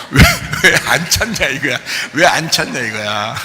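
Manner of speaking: lightly voiced child's complaint, half laughing; mock indignant kid, amused; protesting child. Child following out the adult who stopped searching, mock protest. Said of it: "얘기들이 따라 나옵니다".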